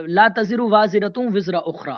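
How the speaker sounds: Quranic recitation. Acted.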